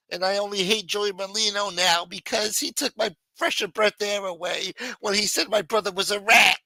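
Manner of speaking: high, loud, ranting mobster; angry Philly gangster voice. Acted. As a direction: rant like an offended wise guy. Voicing Joey Molino's angry rant.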